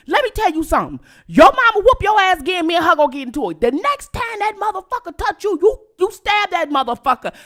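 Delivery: high loud uncle telling her to fight back; heated uncle egging her on; uncle's advice. Retold or imitated. Uncle advising niece.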